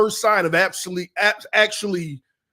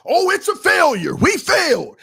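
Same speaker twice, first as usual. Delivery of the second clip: loud, high mock bravado; hammed-up tough talk, shouted; big talk; 8 semitones higher, 4 dB louder than usual. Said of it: "I'm talking that talk".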